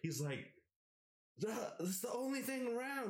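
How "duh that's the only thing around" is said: high scornful yell; mocking retort. Roommate dismisses him, high scornful.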